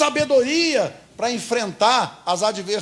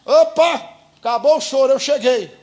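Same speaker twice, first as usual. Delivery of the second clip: raised, louder joyful call; jubilant announcement; 4 semitones higher, 5 dB louder than usual. Acted. Preacher voicing Jesus ending the crying, jubilant. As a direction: proclaim it like good news bursting in.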